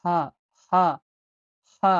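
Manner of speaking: হা with the h pushed from the throat; throaty h sound. Shown as a demonstration. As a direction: separate demo takes of হা, each from the throat. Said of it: "গলা দিয়ে বের করতে হবে".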